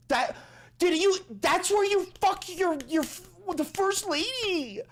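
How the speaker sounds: shrieking high disbelief; high-pitched incredulous outrage. Real, not acted. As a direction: squeal it out in total disbelief. Incredulous rant about Melania.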